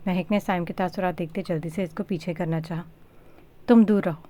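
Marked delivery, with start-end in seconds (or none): 3.7-4.2 loud sharp rebuff; snapping at her. A narrator's reading. Dua snapping at Mehak to stay away, loud and sharp. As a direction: push her away with your voice. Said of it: "दुआ ने महक को पीछे झिड़का".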